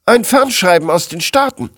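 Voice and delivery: high-pitched urgent announcement; young assistant interrupting. A narrator's reading. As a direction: report it as an eager junior employee. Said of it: "unterbrach ein junger Mitarbeiter". (junger Mitarbeiter meldet dringend, hohe Stimme)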